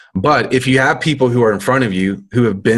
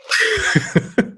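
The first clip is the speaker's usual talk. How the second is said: high giggle; 14 semitones higher than usual. Real, not acted. Reacts to gross remark.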